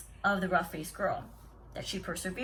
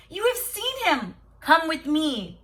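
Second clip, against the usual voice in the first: much higher, delighted exclaiming; wonder and delight; 5 semitones higher, 6 dB louder, slower than usual. Acted. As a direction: burst out with joy and wonder. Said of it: "cried the sister in wonder and delight".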